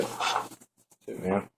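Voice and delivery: breathy whisper under his breath; whispered aside. Real, not acted. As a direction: drop to a whisper for the repeat. Repeats size remark under his breath.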